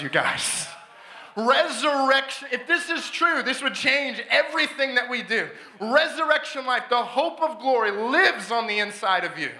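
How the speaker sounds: preaching at full force. Acted. Preaching climax.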